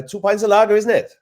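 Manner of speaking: put-on high, loud hammy Welsh accent; bad Welsh accent. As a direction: ham up a Welsh pub order in a Welsh accent. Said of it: "really bad Welsh accent".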